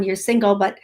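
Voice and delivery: normal talking voice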